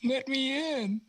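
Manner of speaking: put-on very high, small child pleading; little kid voice. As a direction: squeak it like a tiny kid. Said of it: "Little kid".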